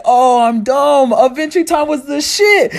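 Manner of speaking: high, loud excited realization; excited shout. Retold or imitated. Dante yelling his excited realization to the neighbors. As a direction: yell it, thrilled.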